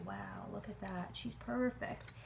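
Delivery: soft, slow, hushed admiration; hushed awe. Real, not acted. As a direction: breathe it in wonder. Awed at unmolded flan.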